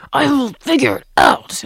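high, loud, every word hammered; all-caps mock yell. Acting out her capital-letter reply, loud exasperated mock yelling. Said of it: "texts me back in capital letters".

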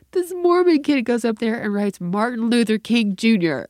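high, loud incredulous retelling; scandalized punchline. Retold or imitated. Daughter reporting classmate's answer, loud high disbelief. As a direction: imitate an outraged kid, pitched right up.

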